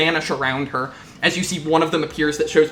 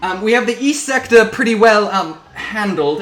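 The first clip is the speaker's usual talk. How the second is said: higher, louder officer, hesitating; Captain Lawrence, flustered; 5 semitones higher, 6 dB louder than usual. Acted. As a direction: cover for the chaos with halting assurance. Captain insists all is handled.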